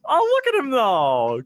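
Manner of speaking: very high, squeaky gushing; high squeal of delight; cute-animal coo. Real, not acted. Cooing over cute animal picture.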